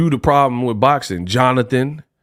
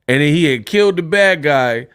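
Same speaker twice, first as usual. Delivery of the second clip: ordinary talk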